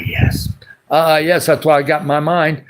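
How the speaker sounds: ordinary talk, nothing marked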